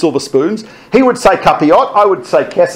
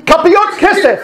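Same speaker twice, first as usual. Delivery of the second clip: panicked yell; 11 semitones higher than usual. Retold or imitated. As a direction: shout the password down from the tower. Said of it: "he yells at him".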